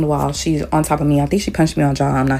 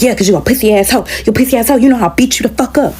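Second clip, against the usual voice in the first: higher-pitched, quick trash talk; mock taunting; 7 semitones higher, faster than usual. Roommate insulting her for refusing to fight, mock taunting. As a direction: imitate a cocky, insulting challenger.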